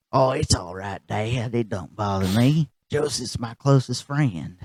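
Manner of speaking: soft, easygoing son brushing it off; Bobby Hill. Retold or imitated.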